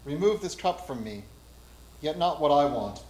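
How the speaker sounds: ordinary talk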